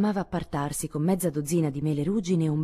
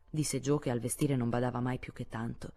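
ordinary delivery, nothing marked

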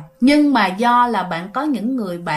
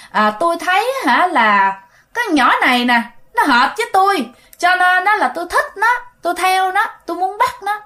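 lightly voiced ghost girl, rising higher; a hint of a spooky young ghost, pitched up; 6 semitones higher than usual. Teller voicing the ghost girl answering grandpa, lightly higher. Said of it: "mới trả lời rằng".

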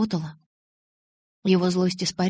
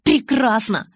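loud, raised, angry agreement; growled; 4 semitones higher, 5 dB louder, slower than usual. A narrator's reading. Bella grudgingly accepting Jacob's ride, growled. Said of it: "прорычала я".

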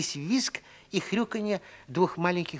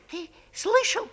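piglet voice; 11 semitones higher than usual. A narrator's reading. Piglet asks brother.

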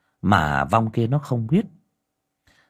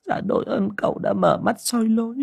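high, humble woman's voice; gratefully bowing to the master; 11 semitones higher than usual. A narrator's reading. Liên humbly thanks cậu Phương, in a high woman's voice.

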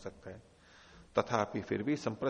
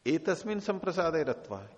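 एतस्मिन् संप्रसादे lifted higher and louder; stressed key words; 5 semitones higher, 3 dB louder than usual. Shown as a demonstration. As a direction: punch एतस्मिन् संप्रसादे up high. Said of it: "teacher stresses key words". (एतस्मिन् संप्रसादे पर ज़ोर)

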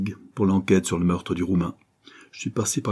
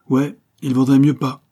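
sharp retort; 6 semitones higher, 7 dB louder than usual. A narrator's reading.

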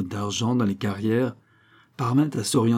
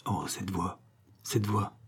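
soft, shaken murmur, much softer; 8 dB quieter than usual. A narrator's reading. Venture recognizing the old voice, a soft murmur. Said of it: "murmura Venture".

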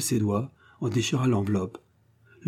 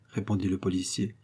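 usual speaking voice

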